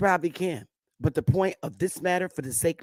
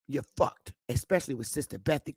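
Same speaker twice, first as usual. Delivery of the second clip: normal talking voice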